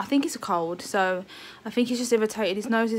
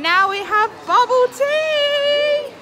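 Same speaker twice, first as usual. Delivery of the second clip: excited; 13 semitones higher, 8 dB louder, much slower than usual. Real, not acted. Excited vlog announcement.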